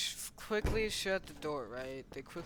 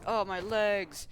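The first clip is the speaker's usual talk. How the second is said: louder, higher, slow wail; mock pain; 5 semitones higher, 6 dB louder, slower than usual. Figure shot in the legs, mock pain wail. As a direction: cry out in pretend agony.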